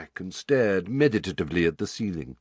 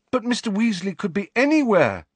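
Harry, anxious; 13 semitones higher, 4 dB louder than usual. A narrator's reading.

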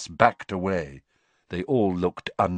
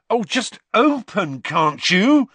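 higher, louder, slow exasperated demand; angry plea; 12 semitones higher, 7 dB louder, slower than usual. A narrator's reading. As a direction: snap at the stubborn statue. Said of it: "he said angrily".

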